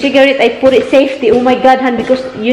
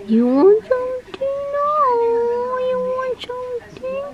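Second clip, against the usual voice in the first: much higher squeaky pet voice. Real, not acted. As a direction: squeak at the dog playfully. Squeaky baby voice to dog.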